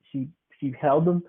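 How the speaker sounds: ordinary talk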